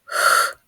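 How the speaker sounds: «х» as a long voiceless rush of air; isolated х sound. Shown as a demonstration. Teacher giving the last sound, isolated long х. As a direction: breathe out a strong, unvoiced «х». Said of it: "звук в нашому слові".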